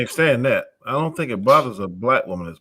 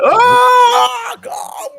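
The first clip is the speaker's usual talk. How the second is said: "Oh, God" flung out as a shrill high shriek, far louder; mock shriek; 6 dB louder than usual. Man mimics an offended woman hearing the C word, mock shriek. Imitating a woman's outraged reaction.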